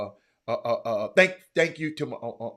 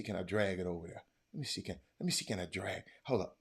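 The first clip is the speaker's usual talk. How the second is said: normal talking voice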